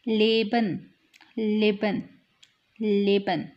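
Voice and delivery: लेबन with a long first ए; long German e. Shown as a demonstration. Teacher drilling the long ए in लेबन. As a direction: stretch the first vowel of लेबन. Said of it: "यहाँ पे भी इसकी long हो जाएगी sound".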